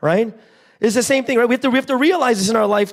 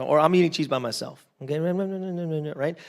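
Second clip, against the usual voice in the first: normal talking voice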